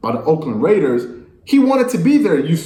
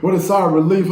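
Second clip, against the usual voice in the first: higher, held-up mock-relieved voice; mock happy relief; 5 semitones higher than usual. Host quoting player on being released, mock happy relief. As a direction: imitate someone happily relieved. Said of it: "he's so happy".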